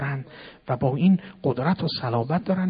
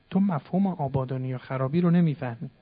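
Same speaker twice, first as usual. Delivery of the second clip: ordinary talk, nothing marked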